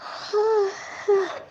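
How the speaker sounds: long airy sigh; real, wordless sigh. Real, not acted. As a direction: let out a long breath. Pause while drawing.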